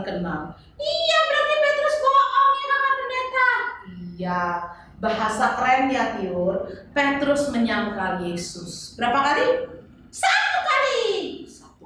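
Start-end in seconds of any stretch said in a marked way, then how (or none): 0.7-3.5 put-on high, sing-song little girl voice; chirpy kid puppet answering the pastor; high child puppet voice. Puppet child Tiur talking to pastor. 10.1-11.0 put-on high, bright kid's guess; high child puppet voice. Tiur answers the count.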